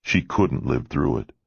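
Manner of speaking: ordinary delivery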